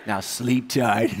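low, slow creepy send-off; mock-sinister goodnight. Husband teasing scared wife at bedtime, slow mock menace. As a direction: tease like a TV villain.

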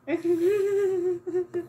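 a high, embarrassed burst of laughter; nervous laugh. Real, not acted. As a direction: laugh at yourself, mortified. Embarrassed while painting on camera.

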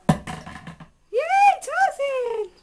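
high, bright cheer for a pet; excited cheer. Real, not acted. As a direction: cheer the bird on happily. Cheering the parrot's trick.

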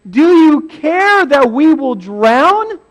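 loud, high, drawn-out plea; hammed-up desperate cry; panicked demand. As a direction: wail it accusingly at the sleeper. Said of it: "They, they say".

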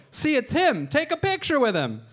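put-on a dad insisting to his child; pushy excited parent. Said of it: "and they'd go".

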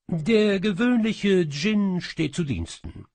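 high, loud, proud cat proclamation; the cat djinn presents itself, high and loud; announcing cat djinn. Acted. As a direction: announce yourself grandly. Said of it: "verkündete die Katze".

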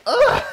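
very high, loud squealed grunt; throwing grunt. Real, not acted. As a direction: strain out a yelp that tips into laughter. Last throw, breaking into laughter.

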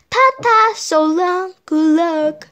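high sing-song farewell; called over shoulder. A narrator's reading. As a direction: call out goodbye brightly. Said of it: "called over her shoulder".

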